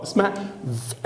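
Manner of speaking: usual speaking voice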